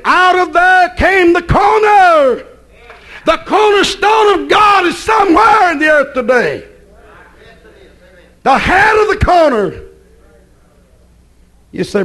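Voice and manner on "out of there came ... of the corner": shouted preaching. Acted. Line repeated as shouted climax.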